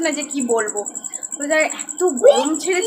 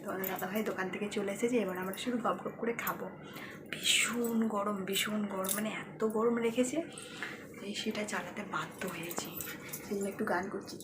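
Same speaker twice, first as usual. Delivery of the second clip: much softer near-whisper; 2 semitones lower, 14 dB quieter than usual. Real, not acted. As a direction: keep it very soft, the daughter is asleep. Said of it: "খুব আস্তে কথা বলছি".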